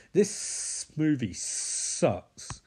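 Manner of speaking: drawn-out disgust. Real, not acted. Dragged-out verdict on the movie.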